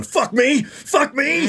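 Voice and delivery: high, strained mock shout; shaking with rage. Friend reenacts promoter's outburst, mock shaking rage. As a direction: imitate someone so furious he shakes. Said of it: "like, shaking".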